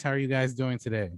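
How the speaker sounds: ordinary talk, nothing marked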